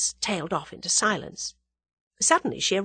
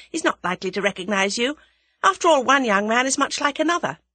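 higher, louder, energetic female character; young woman character, brisk; 8 semitones higher, 5 dB louder than usual. Acted. Young woman planning to shadow a man, brisk. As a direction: lay out the scheme briskly and emphatically.

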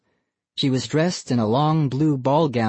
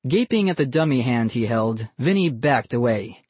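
ordinary delivery

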